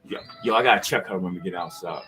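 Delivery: low, fast whisper to himself; hushed, hurried aside. Acted. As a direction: mutter it so she can't hear. Private aside about his woman.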